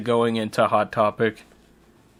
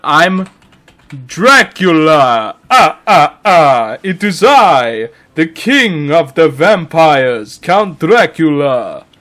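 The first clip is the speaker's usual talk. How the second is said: theatrical vampire count with drawn-out, swooping words; Dracula; 5 dB louder, slower than usual. Pretend. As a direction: announce yourself grandly like a hammy vampire. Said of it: "read it in the Dracula voice".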